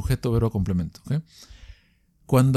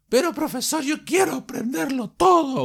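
much higher, pleading student shout; eager student; 11 semitones higher than usual. Pretend. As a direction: whine it like a student who wants it all. Teacher voices an eager student.

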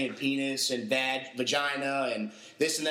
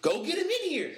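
bossy order; 13 semitones higher than usual. Retold or imitated. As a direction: send someone to fetch him, firmly. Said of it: "And she's like".

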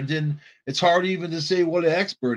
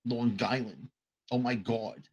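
put-on North Shore accent; 3 semitones lower, 8 dB quieter than usual. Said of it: "where they talk like this".

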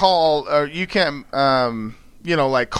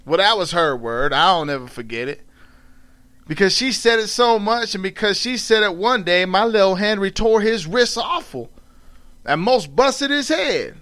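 storytelling in dialect; 5 semitones higher than usual. A narrator's reading. Reading Mark Twain aloud as the character, in Southern dialect. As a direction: read it as an old Southern storyteller.

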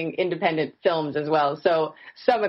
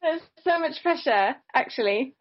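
English accent; 8 semitones higher than usual. Shown as a demonstration. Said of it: "I love it when people switch like that".

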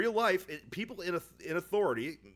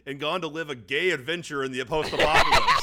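laughing; 8 dB louder than usual. Real, not acted. Teasing, laughs.

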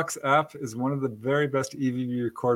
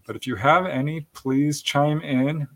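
ordinary talk

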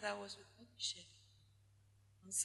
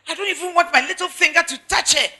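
loud, higher, fast mock complaint; imitating repulsed husband; 5 semitones higher, 6 dB louder than usual. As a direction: act out a disgusted husband venting in counseling. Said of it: "When they come for counseling".

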